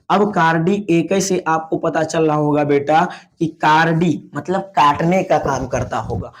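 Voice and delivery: normal talking voice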